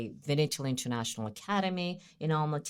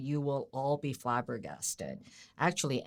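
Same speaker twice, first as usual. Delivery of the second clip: ordinary delivery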